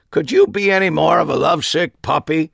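a mocking aside that carries too far; not quiet enough. Harry teasing his friend, a loud mocking aside. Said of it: "not nearly as quietly as I would have liked".

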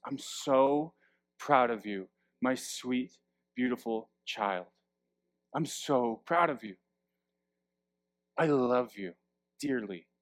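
tender loving parent. Acted. Loving parent hugging the child.

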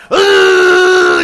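loud, high engine rev imitation; revving engine noise. Driver keeping a bad car from stalling, loud revving noise.

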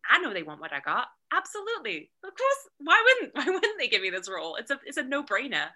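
high, loud burst of cocky certainty; supremely confident. Acted. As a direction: act utterly sure of landing the role. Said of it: "if you could be that confident".

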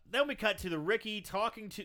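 usual speaking voice

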